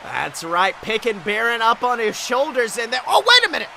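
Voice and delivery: ordinary talk, nothing marked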